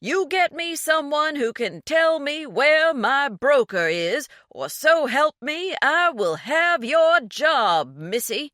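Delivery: furious threat. A narrator's reading. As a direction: threaten her job at full bluster. Angry threat on the phone.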